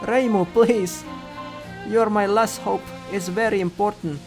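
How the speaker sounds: high, loud plea; urgent pleading. A narrator's reading. Pleading with Reimu for help.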